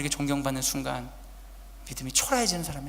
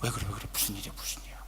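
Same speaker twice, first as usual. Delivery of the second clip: hushed anxious whisper; a mother frightened on the phone; worried mother; 8 semitones lower, 6 dB quieter, faster than usual. Retold or imitated. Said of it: "얼마나 놀랐겠어요".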